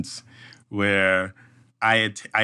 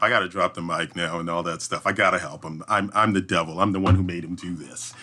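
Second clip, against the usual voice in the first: ordinary talk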